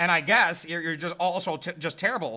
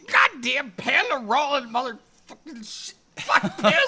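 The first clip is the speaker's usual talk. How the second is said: swearing, laughing; 3 semitones higher, 5 dB louder than usual. Acted.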